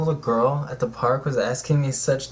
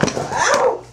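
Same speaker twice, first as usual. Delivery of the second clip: sharp high yelp; 5 dB louder than usual. Real, not acted. Hit by something.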